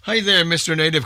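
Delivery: ordinary talk, nothing marked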